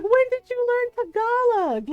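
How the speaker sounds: high, loud, laughing question; excited surprise. Retold or imitated. Uncle amazed his wife speaks Tagalog, excited and laughing. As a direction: burst out delighted and amazed. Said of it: "she's like".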